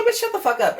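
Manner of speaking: high sharp mock yell; mock snap. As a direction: snap at her, hammed up and high. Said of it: "I'm like".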